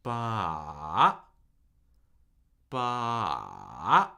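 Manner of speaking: "Ba." held long in a low trough, then swept up; very slow falling-rising "Ba."; slow third tone. Shown as a demonstration. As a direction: stretch "Ba." down, hold it low, lift the end. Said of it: "make sure you can do it slowly first".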